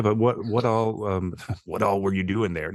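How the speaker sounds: usual speaking voice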